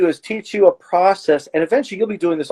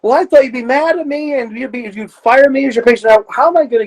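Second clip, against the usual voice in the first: higher, worried patient voice; lightly voiced anxious patient; patient's voice; 6 semitones higher than usual. Patient voiced in retold session.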